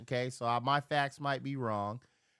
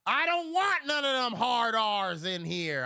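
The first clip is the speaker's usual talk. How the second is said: retold yell; 6 semitones higher, 4 dB louder than usual. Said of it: "I told him".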